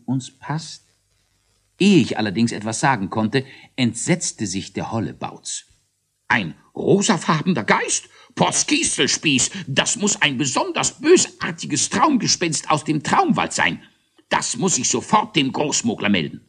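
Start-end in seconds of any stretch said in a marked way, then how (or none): 6.3-16.4 high, shocked little voice; alarmed outcry. Acted. Hollebauz horrified by the pink ghost, high alarmed voice. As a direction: gasp it out in horror. Hollebauz entsetzt sich, erschrocken.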